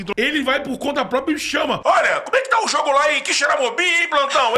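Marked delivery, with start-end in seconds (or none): 1.8-4.6 higher, louder host calling; calling out loudly. Retold or imitated. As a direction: call out across the studio. Voicing the show host calling out.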